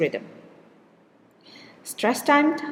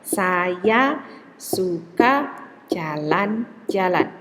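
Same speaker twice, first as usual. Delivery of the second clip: slow and even, every syllable equal; "Saya suka jalan-jalan" spoken slowly with equal beats on saya, su, ka, ja, lan; syllable-timed demo; slower than usual. Shown as a demonstration. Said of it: "I pronounce it at the same amount of time".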